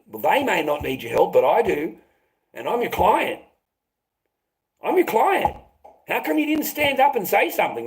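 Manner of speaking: builds from firm to high, indignant pleading; rising anger at his own lawyer; retelling how he confronted the lawyer, indignant; heated retort. Retold or imitated. Retold heated reply to his lawyer.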